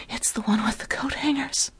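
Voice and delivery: high, whispered sultry caller voice; turned-on phone caller, half-whispered; breathy caller. Acted. Aroused caller answers in breathy voice.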